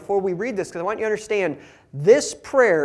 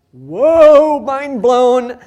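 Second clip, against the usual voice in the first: much higher, much louder mock awe; mock amazement; 8 semitones higher, 15 dB louder, much slower than usual. Preacher mocking the disciples' amazement, hammed-up awe. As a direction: ham up the disciples being stunned. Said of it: "and they're like".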